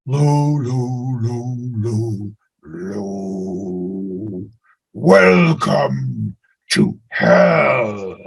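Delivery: very slow, low and flat intoned line; slow low intoned mystic voice; deep flat yoga voice. Shown as a demonstration. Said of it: "read it flat".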